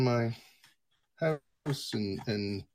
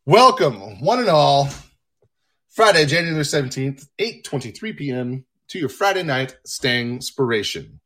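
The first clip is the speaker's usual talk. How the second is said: loud, higher-pitched announcer greeting; show host welcome; 5 semitones higher, 8 dB louder than usual. Real, not acted. Host welcoming folks to Friday night show, big announcer voice. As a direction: project a big opening to the audience.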